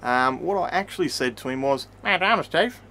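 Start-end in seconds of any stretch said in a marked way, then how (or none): 2.0-2.8 louder, higher quick introduction to a cop; meek real reply. Retold or imitated. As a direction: blurt your name politely to the officer. Admits what he really said to cop.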